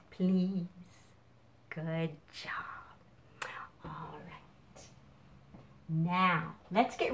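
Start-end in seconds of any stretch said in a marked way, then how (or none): 1.7-4.8 whispered praise; whispering. Real, not acted. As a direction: drop to a hushed whisper. Sudden hush after the song.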